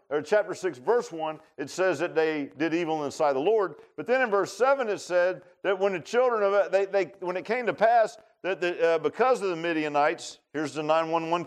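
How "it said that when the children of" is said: ordinary talk, nothing marked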